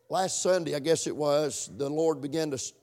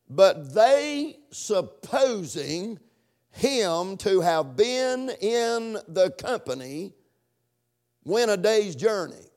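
slow, raised, drawn-out Bible reading; emphatic scripture reading; 3 semitones higher, slower than usual. Acted. Preacher driving home the verse, slow and emphatic. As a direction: stretch out each phrase for the congregation.

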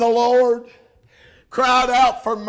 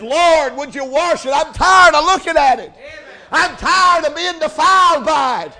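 high, loud pleading shout; preacher voicing David begging God for cleansing; fervent preached plea; 4 semitones higher, 5 dB louder than usual. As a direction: beg to be washed clean with all your force.

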